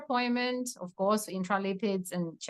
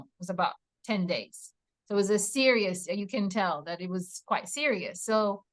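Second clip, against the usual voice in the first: normal talking voice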